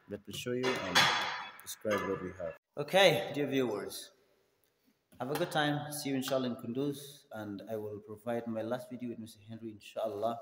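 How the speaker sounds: ordinary talk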